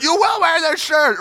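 high, loud parental order; exasperated parent. Acted. Parent ordering a child to wear the shirt, high exasperated yell. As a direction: lay down the law in a mock-frantic parent voice.

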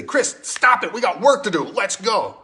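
high, loud, brisk rebuke; impatient Jesus. Retold or imitated. Jesus scolding a crying follower, loud impatient voice. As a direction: snap him out of it, sharply. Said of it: "I would expect Jesus to say".

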